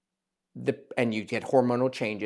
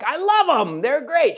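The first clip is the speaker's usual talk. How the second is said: much higher, bright mock-patient gushing; animated delight; 12 semitones higher, 5 dB louder, slower than usual. Surgeon imitating patient's delight at the mirror, high and excited. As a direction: gush like a thrilled patient at the mirror. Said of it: "She was so animated".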